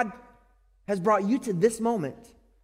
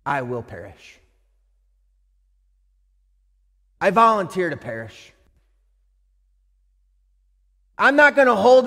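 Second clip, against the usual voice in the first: resolved; 5 dB louder than usual. Retold or imitated. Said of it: "Jesus said".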